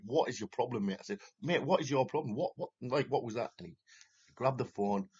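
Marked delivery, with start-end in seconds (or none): 0.1-3.5 retold confrontation. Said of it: "I was like".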